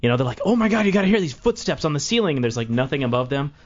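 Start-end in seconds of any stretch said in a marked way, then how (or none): 0.5-2.4 high, breathless-with-news mock excitement; excited investigators. Investigators telling their colleague about footsteps, high excited imitation. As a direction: imitate thrilled colleagues, pitched well up.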